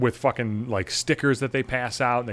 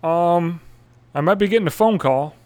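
sheepish admission; 5 semitones higher, 4 dB louder than usual. Retold or imitated. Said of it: "I'm like".

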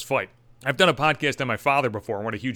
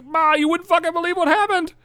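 put-on whiny kid; 3 dB louder than usual. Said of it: "I'm like".

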